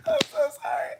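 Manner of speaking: high, breathless squeaked apology; helpless laughter. Real, not acted. Apologising to viewers mid-fit, high breathless laughing squeak. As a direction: squeeze it out while cracking up.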